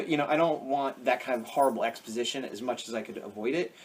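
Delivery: ordinary talk, nothing marked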